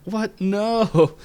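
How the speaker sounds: high, loud mock panic; freaking out. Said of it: "they starts freaking out".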